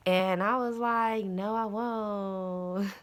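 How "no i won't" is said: very slow, stretched-out reply; drawn-out refusal. Retold or imitated. She answers the voice in her head, drawn-out refusal. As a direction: stretch every word of the refusal.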